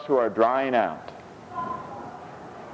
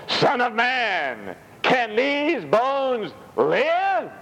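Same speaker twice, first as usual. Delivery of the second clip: slow, drawn-out high voice of God; voicing the Lord, slowly; 11 semitones higher, much slower than usual. Preacher voices the Lord's question to Ezekiel, slow and high.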